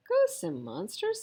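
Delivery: very high disbelieving question; surprised. A narrator's reading. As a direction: echo it in disbelief. Astonished question.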